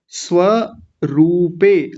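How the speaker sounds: स्वरूपे drawn out very slowly; slow demo. Shown as a demonstration. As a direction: sound out स्वरूपे slowly, every syllable. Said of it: "और आगे है स्वरूपे".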